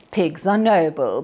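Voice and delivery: ordinary delivery, nothing marked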